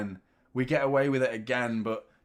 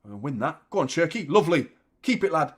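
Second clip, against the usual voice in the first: ordinary talk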